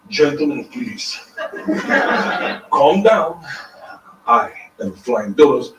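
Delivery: put-on low, measured German-accented woman; hint of a stern German stage performer; light German accent. German performer addressing a rowdy crowd, light German accent. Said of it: "German woman, six feet tall".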